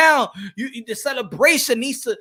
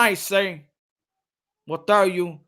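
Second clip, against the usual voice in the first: ordinary talk, nothing marked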